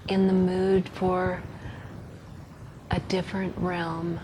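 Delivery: soft, hushed meditation voice with long pauses; guided meditation. Acted. Leading listeners into a visualized walk on a rock path, soft guided meditation. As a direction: guide them gently, softly, leaving room to breathe.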